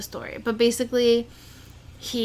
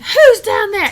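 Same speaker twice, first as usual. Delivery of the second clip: loud, high call-out; retold alarmed shout; 10 semitones higher, 12 dB louder, much slower than usual. Retelling hearing him back in the house, alarmed shout. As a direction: call out sharply to an intruder.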